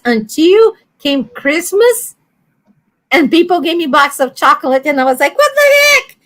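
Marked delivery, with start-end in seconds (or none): 5.4-6.2 high, sudden what-the-heck; baffled outburst. Retold or imitated. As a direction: blurt it out, caught off guard. Baffled by a box of chocolates.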